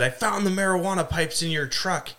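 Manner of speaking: high accusing mother voice; frantic mom. Retold or imitated. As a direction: mom confronting her son, imitated high. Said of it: "or I think she said".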